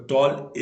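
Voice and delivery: टॉल in one unbroken tone; teacher sounding out टॉल as one syllable; one-syllable demo. Shown as a demonstration. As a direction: say टॉल as one stressed, single beat. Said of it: "एक ही tone में खत्म हो गया".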